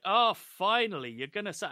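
eager relief. Retold or imitated. Gang expecting Mac to come out.

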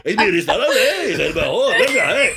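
put-on high, laughing, incredulous waiter in a thick Spanish accent; a waiter amazed anyone would ask, in a hammed-up Spanish accent; exaggerated Spanish waiter. A Spanish waiter answering a customer, exaggerated and high. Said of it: "exaggerated Spanish waiter".

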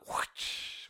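breathy whip-crack whoosh; whip noise. Pretend. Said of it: "That's a whip noise".

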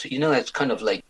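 usual speaking voice

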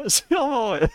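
high-pitched laughing fit; helpless burst of laughter; laughing hard. Real, not acted. (fou rire aigu)

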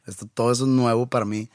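ordinary talk, nothing marked

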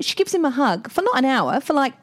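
ordinary talk, nothing marked

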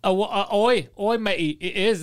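put-on loud, botched English accent turning pirate; mock a bad English accent like a pirate; pirate-like fake English accent. English critics mocking [name]'s accent, pirate-like English. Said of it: "that's a pirate".

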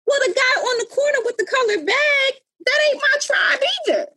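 mock retort.

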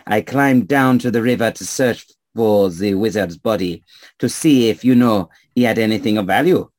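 put-on storytelling in a French accent; French-accented traveller spinning a tale. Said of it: "stranger with a French accent".

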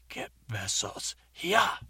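slow announcer title drop; show title. Acted. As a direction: announce the show name slowly.

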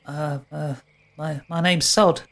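high, hesitant faun voice; shy faun boy. Acted. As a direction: stammer it like a timid faun.

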